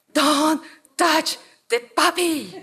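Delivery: high, slow, word-by-word warning; mock stern warning. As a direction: draw out each word to warn them off. Professor warning students off poodle.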